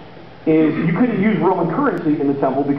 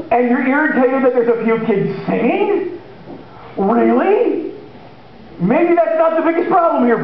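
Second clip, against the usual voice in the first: higher, incredulous rebuke; exasperated Jesus; 5 semitones higher than usual. Retold or imitated. Jesus chiding the offended leaders, higher and exasperated. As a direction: scold them in disbelief. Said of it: "I can just imagine him".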